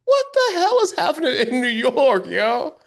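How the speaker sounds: very high, loud exclamation of disbelief; loud, high-pitched bewilderment; incredulous outburst. Real, not acted. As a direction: yell it high, in utter disbelief.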